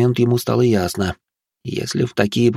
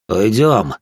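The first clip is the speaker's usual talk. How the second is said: ordinary delivery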